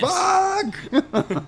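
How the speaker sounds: very high laughing yelp; laughing squeal. Real, not acted. Friends giggling over the swearing, laughing squeal.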